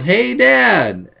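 high pitched "Dad" dragged out; a boy shouting mid-jump; retold yell. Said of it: "He had jumped and then yelled".

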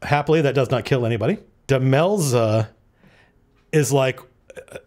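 usual speaking voice

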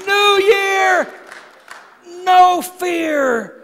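shouted, high and slow preaching; full-force preaching. Real, not acted. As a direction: preach it out at the top of the voice.

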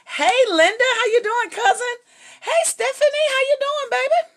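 very high-pitched, delighted hello; excited, affectionate high-pitched welcome; gushing greeting. Real, not acted. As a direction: greet arriving family in a high squeal. Greeting relatives joining the livestream.

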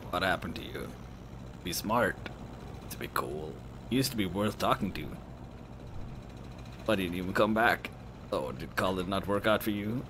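restrained, cutting disappointment; bitter friend. Acted. Voices bitter friend character.

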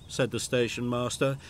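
ordinary delivery, nothing marked

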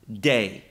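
"day" pronounced like the Greek dei, said low and drawn out; Greek word dei. Shown as a demonstration. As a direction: say "day" carefully as a Greek word, dropping the pitch. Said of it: "It is the Greek word".

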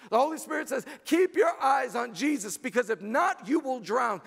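fervent preacher's cry; preaching. Acted. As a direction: proclaim it over the church. Preaching at full force.

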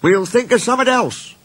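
very high-pitched little engine; cheering-up voice. A narrator's reading. Rusty consoling Skarloey, high reassuring voice.

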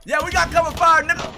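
ordinary talk

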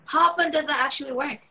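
high, fast incredulous woman's voice; young woman's voice. Acted. Woman quizzing her catfishing date, high and fast.

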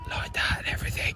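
whispered aside; hushed whisper while miming. Real, not acted. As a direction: breathe it out unvoiced. Imitating meditation pose.

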